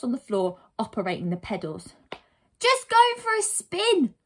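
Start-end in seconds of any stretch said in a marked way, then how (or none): 2.6-4.1 put-on high, loud, slow boy's voice; cheeky little boy. Boy excuses crashing into the hedge, cheeky boy voice. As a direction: pipe up breezily as a small boy.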